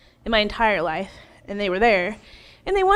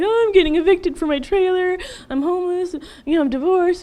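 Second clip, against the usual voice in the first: high, louder retold wail of woes; mock lament; 7 semitones higher, 4 dB louder than usual. Woman retelling how she told Shelley her story, mock lament. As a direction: reel off troubles in a high, plaintive voice. Acts out pouring out her troubles.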